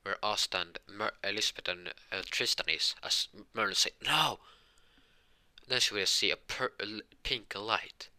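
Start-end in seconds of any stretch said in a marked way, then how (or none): 4.0-4.4 high, loud cry of no; alarmed cry. Acted. Merlin seeing a demon crash onto the castle, alarmed cry.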